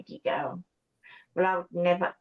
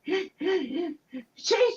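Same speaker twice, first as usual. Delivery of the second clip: crying; 9 semitones higher, much slower than usual. Real, not acted. Caller breaking down on live panel.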